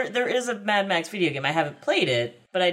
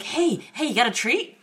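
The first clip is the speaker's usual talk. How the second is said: eager dog; 6 semitones higher than usual. Retold or imitated. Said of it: "the dog's like".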